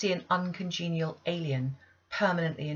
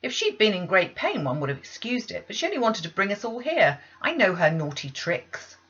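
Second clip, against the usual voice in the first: loud, scornful complaint; disgusted scolding; 3 semitones higher, 6 dB louder than usual. Acted. Maid complaining about the child's tricks, disgusted. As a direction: scold with contempt. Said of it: "declared Abbott in some disgust".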